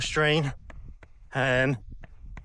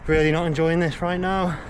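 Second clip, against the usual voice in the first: panting, pushing through pain; out of breath. Real, not acted. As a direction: gasp out the words. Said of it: "Can't even aim the camera".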